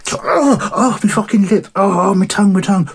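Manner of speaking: high, loud yelps of a burnt mouth; mock pained yelp. Jesus burning his tongue on his burrito, high pained yelps. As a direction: cry out over a scalded tongue and lip.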